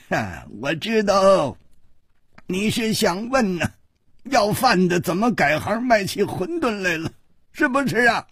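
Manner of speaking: put-on high-pitched, teasing old-timer; amused old man's high voice; knowing old man. A narrator's reading. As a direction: play an elder who has guessed the question. Old man guesses the question.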